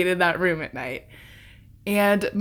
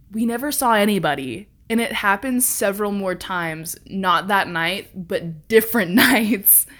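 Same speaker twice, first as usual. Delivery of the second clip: ordinary delivery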